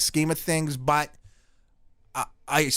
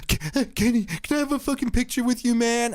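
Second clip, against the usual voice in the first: high, breathless fanboy voice, gasping out the name; hyperventilating star-struck kid; 11 semitones higher than usual. Retold or imitated. Kid fan at Kenny's door, high and breathless. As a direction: play the panting young fan at the door. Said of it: "all hyperventilating".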